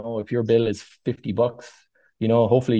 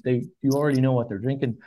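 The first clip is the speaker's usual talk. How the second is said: normal talking voice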